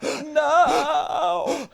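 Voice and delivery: long high gasped "no"; mock-horrified inhaled wail; sucking words back. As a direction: wail no while sucking air in. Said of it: "try to suck it back in".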